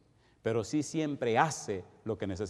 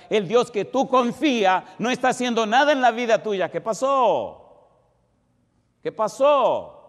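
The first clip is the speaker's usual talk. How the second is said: loud, raised-pitch sermon delivery; full-force preaching; 6 semitones higher, 9 dB louder than usual. Real, not acted. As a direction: drive each question home to the congregation, forcefully. Predica a toda fuerza. (predicación enérgica a toda voz)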